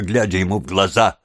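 plain reading voice

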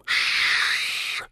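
long voiceless "shhh" hiss; sharp hushing hiss. A narrator's reading. Gollum hushes the resting hobbits, hissing. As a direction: hiss a drawn-out shush. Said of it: "шипел Горлум".

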